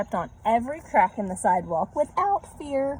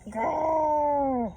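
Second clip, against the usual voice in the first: growl; 7 semitones higher than usual. A narrator's reading. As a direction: growl like a playful dog. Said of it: "She growled at stray dogs".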